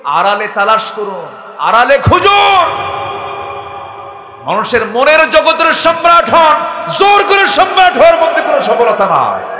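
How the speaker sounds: shouted, high sermon climax; preacher shouting his exhortation, high and loud; full-force preaching. Acted. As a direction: thunder it at the crowd.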